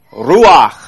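ruach with a loud, breathy ch at the end; ruach, spitting the final sound; Hebrew ruach. Shown as a demonstration. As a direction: push air out on the ch of ruach. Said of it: "to even say the word ruach".

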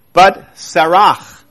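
Sarach said slowly, with a throaty ch at the end; Hebrew pronunciation. Shown as a demonstration. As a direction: stretch out Sarach and rasp the final ch. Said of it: "in Hebrew shall be her name".

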